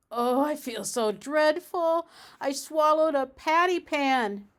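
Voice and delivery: high, loud whiny moan; moaning and whining. A narrator's reading. Duchess moaning to Ribby about the patty pan. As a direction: whine it like a sick cat. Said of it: "Duchess moaned and whined".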